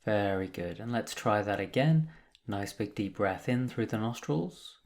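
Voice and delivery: calm, measured meditation guide with long pauses; guided meditation. Acted. Meditation teacher guiding listeners through breath and body, calm and unhurried. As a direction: lead them gently into stillness, leaving space between phrases.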